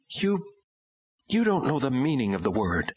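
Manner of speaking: a hint of a bitter teenage girl; daughter voice. A narrator's reading.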